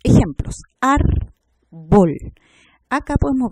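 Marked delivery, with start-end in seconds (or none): 0.8-2.1 Árbol in two loud, separate beats; syllable by syllable. Shown as a demonstration. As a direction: split Árbol as ÁR, pause, BOL. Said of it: "la primera sílaba".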